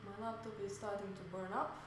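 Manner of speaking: normal talking voice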